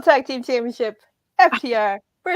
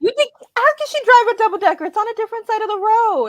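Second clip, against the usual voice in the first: high, incredulous mock question; mock puzzled; 10 semitones higher, 5 dB louder, faster than usual. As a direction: play it baffled and high.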